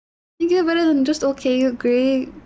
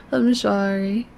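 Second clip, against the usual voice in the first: lower, fading, teary apology; teary sorry; 7 semitones lower, 4 dB quieter, slower than usual. Real, not acted. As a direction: let the sorry sink to almost nothing. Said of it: "a little emotional sometimes".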